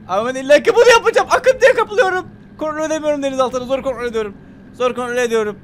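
high, loud cry of alarm; frantic, strained-high yelling; panicked. Real, not acted. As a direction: raise the pitch and shout as control slips away. Panicking as current pulls sub.

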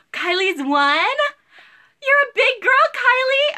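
high, loud gasping coo; gasp, then coo like to a toddler; baby talk. Acted. Seller marvels at a viewer's one-year-old in high baby talk. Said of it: "talking like you're the baby".

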